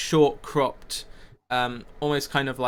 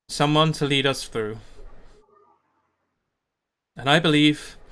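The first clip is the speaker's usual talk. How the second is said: solemn town leader. Acted. Leader speaking to his community of Glaric's crime, measured and solemn. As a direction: address the crowd with long pauses. Game master voices a leader's speech.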